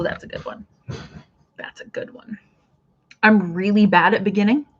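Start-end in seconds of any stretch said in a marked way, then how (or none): 0.0-0.7 soft whisper, almost no voice; whispered approval. Real, not acted. Host quietly praising a viewer's word, whispered. 1.6-2.4 soft whisper, almost no voice; whispered approval. Real, not acted. Host quietly praising a viewer's word, whispered.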